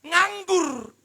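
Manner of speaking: high, loud Nganggur flung out; shouted jab. Acted. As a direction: fling the word Nganggur at them. Preacher hammers the point. (Nganggur diteriakkan keras dan tinggi)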